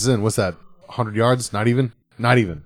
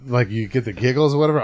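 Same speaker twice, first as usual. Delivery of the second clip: usual speaking voice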